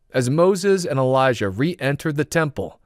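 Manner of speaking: ordinary delivery, nothing marked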